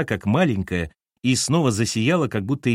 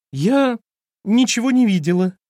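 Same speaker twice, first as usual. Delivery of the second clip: high, falsely innocent denial; 12 semitones higher, 6 dB louder than usual. A narrator's reading. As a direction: pretend you saw nothing, too brightly. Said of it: "заявила она фальшиво". (фальшиво, высоким голосом)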